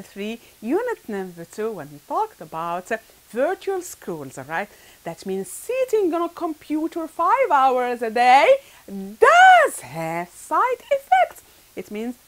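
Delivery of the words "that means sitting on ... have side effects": "does" stretched out, high and loud; slow, raised-voice emphasis on "does"; emphatic do. Shown as a demonstration. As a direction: lean hard and long on "does". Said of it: "what we call the emphatic do".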